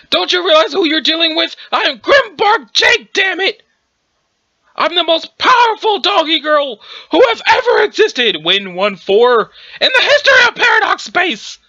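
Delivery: high, shouted indignant rant; outraged boasting. Acted. Overpowered fighter reminding foe who they face, outraged shouting. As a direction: bellow the boast in disbelief at losing.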